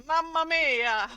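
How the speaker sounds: slow hammed-up Italian Mamma mia; a bad Italian accent, drawn out; cheesy fake Italian exclamation; mock Italian. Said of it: "Excusez, c'est, mon accent, euh, italien, euh, pue".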